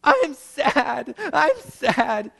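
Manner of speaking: high-pitched mock toddler wail; imitated bawling. Daughter answers dad's question, mock bawling in a high voice. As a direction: wail it like a small child, hammed-up. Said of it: "She's bawling, bawling".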